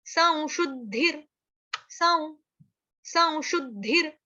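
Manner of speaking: संशुद्धिर said as साउ शुद्धिर, slow, rising on साउ; pron साउ. Shown as a demonstration. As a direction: stretch साउ then शुद्धिर. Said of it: "उसका उच्चारण होगा साउ". (अनुस्वार को साउ बोलकर)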